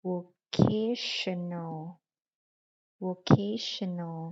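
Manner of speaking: vocational slowly, syllable by syllable, KAY stressed. Shown as a demonstration. Teacher shows where the stress falls, vocational as vo-KAY-shuh-nal. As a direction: lean on the second syllable of vocational. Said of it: "คำนี้จะเน้นเสียงอยู่บนคำว่า K".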